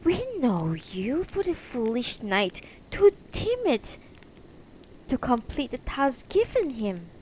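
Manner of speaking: high, loud taunt from a woman; calling up. Acted. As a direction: call up scornfully at a knight. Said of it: "one woman calls up".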